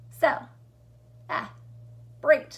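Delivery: "Cel, ah, break" as three loud, separate beats; chunked into syllables. Shown as a demonstration. Teacher showing how celebrate splits, "Cel, ah, break" chunked out. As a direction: pause fully after each piece of "Cel, ah, break". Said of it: "that's how the word is chunked out".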